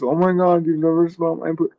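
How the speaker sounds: high, mocking girlfriend voice; mock woman. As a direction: lift it up high and mock her. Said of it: "and it's like this".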